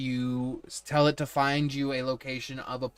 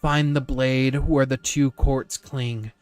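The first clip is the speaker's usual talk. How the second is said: ghostly oracle; 8 dB louder, slower than usual. Acted. Mysterious figure speaks a rhyme.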